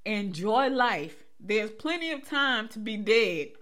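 slow, firmly projected quote; drawn-out, deliberate punchline delivery; emphatic repeat. Real, not acted. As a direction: spell it out slowly for anyone who missed it. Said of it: "let me say it again 'cause somebody missed it".

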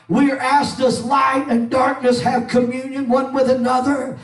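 high, loud fiery preacher's cry; preaching at full force. Acted. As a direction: preach it out hard over the music. Preaching over music.

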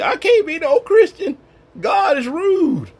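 high, loud, hammed-up protest; mock outraged believer. Believer complaining to the congregation that God is rude. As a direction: squeal it like an offended churchgoer.

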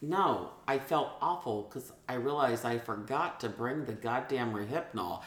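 ordinary talk, nothing marked

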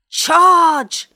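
high, very loud rallying shout; tiny warrior's yell; battle cry. A narrator's reading. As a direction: sound the charge. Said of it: "sounded another".

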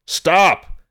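sudden high shouted command; sharp, high-pitched yell to halt. A narrator's reading. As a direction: yell it out like an urgent warning. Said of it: "he yelled".

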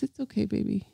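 soft, tender reassurance; soft comforting. Retold or imitated. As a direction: coo it like soothing a partner. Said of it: "a touch that says".

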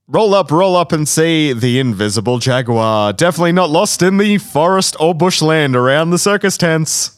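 loud, high showman call; circus barker. Pretend. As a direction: bark it out like a ringmaster.

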